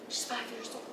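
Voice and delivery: hushed whisper, almost no voice. Real, not acted. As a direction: breathe it out in disbelief. Stunned aside about the child.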